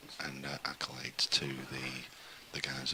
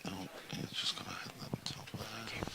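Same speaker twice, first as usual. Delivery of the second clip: snooker commentary whisper; 5 semitones higher, 5 dB quieter than usual. Acted. Hushed commentary during shot.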